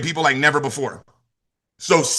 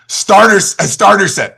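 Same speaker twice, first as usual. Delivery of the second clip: "Starters, a starter set" higher and louder; emphatic correction; 4 semitones higher, 6 dB louder, slower than usual. Real, not acted. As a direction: hammer home "starter set". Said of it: "why did I say travel set?".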